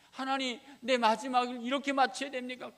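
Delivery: loud, high anguished cry; desperate prayer. Acted. Dying king asking God if this is the end, anguished cry. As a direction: wail it to God.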